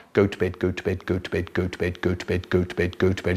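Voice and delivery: low, soft monotone chant; droning nagging brother. Retold or imitated. Older brother endlessly ordering sibling to bed, low nagging drone. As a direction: drone it like a stuck record.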